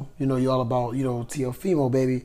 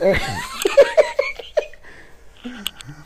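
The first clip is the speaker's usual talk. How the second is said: squealing high laugh. Real, not acted. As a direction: burst out in a high giggle. Friend mocks the husky voice.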